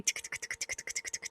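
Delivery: soft unvoiced tongue clicks like a tapping pen; pen clicking noise. Shown as a demonstration. Teacher mimics a nervous pen-clicker for her class, quiet unvoiced clicks. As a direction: tick it out with the tongue, no voice. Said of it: "sigue haciendo un ruidito".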